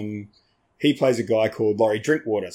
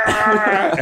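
mock angry growl; 10 semitones higher, 5 dB louder than usual. Getting angry at jam.

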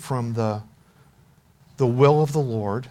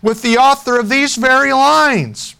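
high, loud, thrilled amazement; delighted exclamation; 10 semitones higher, 7 dB louder than usual. A narrator's reading. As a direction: build excitedly to a high, ringing finish. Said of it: "she exclaimed".

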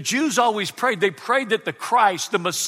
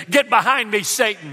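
sharp mock rebuke, louder; pretend rebuke; 4 semitones higher, 6 dB louder than usual. Pastor pretending to rebuke a churchgoer, mock stern. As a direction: bark it at the asker like a scolding.

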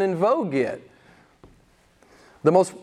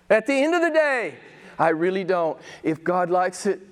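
ordinary delivery

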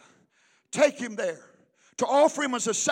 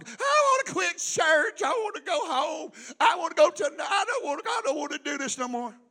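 put-on high whining child voice, near tears; mock a crying kid begging to quit church; hammed-up childish whimper; whiny kid; 4 semitones higher than usual.

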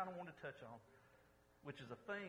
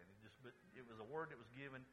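ordinary talk, nothing marked

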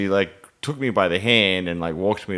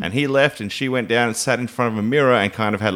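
usual speaking voice

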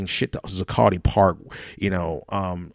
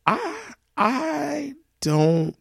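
mock crying; much slower than usual. Cry heard, slow, high.

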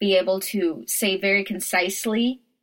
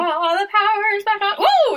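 much higher, loud singsong whoop; real excited cheer; 11 semitones higher, 4 dB louder than usual. Real, not acted. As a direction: celebrate the lights coming back. Lights return mid-recording.

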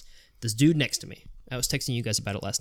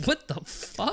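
much higher, incredulous squeak; stunned disbelief; 13 semitones higher, slower than usual. Retold or imitated. Storyteller reacting to Tommy scolding a stranger, incredulous high squeak. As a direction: let the shock jump up in pitch.

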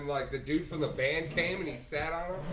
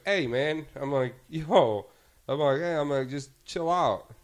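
louder, raised-voice call to back off; retold firm protest; 3 semitones higher, 11 dB louder than usual. Bartender telling a rude customer to chill, retold firm protest. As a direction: re-enact stepping in on a rude customer.

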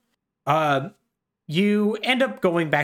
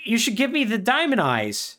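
high voiced fake friend; 11 semitones higher than usual. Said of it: "And he's like".

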